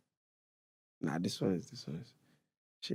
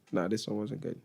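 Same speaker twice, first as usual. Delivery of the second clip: ordinary talk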